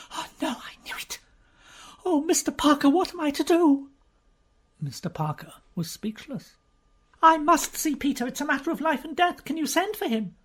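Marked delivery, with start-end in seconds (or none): 0.1-3.9 high, crying out in distress; a frightened lady near tears; nervous agitation. Acted. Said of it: "in an extreme state of nervous agitation". 7.2-10.3 high, urgent plea; desperate young lady begging; high, rushed and insistent; pleading Lady Mary. Acted.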